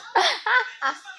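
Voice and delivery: high squealy mock giggle of a small boy; mother mimics her son's delighted giggle; imitating his laugh. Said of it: "ele faz".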